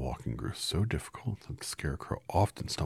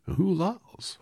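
higher, louder curious straw man; lightly voiced Scarecrow; 8 dB louder, slower than usual. Scarecrow asks Dorothy who she is, higher and louder. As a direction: pop the question up high.

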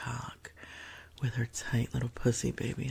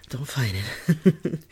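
ordinary delivery

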